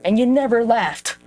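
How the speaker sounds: a hint of the ex playing dumb and wounded; mocking the ex. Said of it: "My ex would be like".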